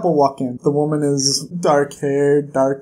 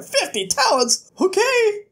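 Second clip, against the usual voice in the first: mock surprised treasurer. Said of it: "treasurer is like".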